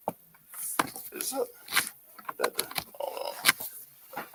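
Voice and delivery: hushed off-mic asides, a few unvoiced; dropping the voice to fix his setup mid-talk; quiet aside. Real, not acted. Podcaster muttering while replugging his mic, hushed and breathy. Caught with gear unplugged.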